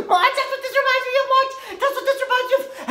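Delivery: high, fast, through laughter; laughing excitedly. Acted. Telling Marge what the outfit recalls, high laughing squeal. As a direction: squeal it out while cracking up. Laughing after the acted movie scream.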